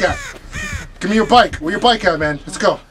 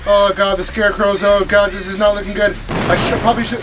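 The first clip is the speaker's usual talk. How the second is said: ordinary talk, nothing marked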